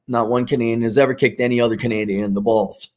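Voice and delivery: normal talking voice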